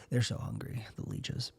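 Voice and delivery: soft, low, trailing near-whisper; hushed mock drama. Ending the leeches fantasy, hushed and dramatic. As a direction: sink to a spent murmur. Dark afterthought to the bit.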